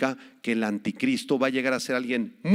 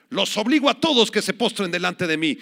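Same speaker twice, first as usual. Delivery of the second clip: antichrist command; 5 semitones higher, 4 dB louder than usual. Retold or imitated. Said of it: "va a decir".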